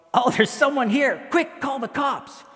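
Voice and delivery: high, loud alarm call; alarmed shout. Acted. Husband spots intruder and calls to wife, alarmed shout. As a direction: shout urgently for help.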